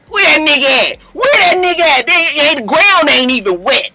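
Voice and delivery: put-on high, indignant LA-accented holler; imitated LA man, hammed-up disbelief; mock LA street accent.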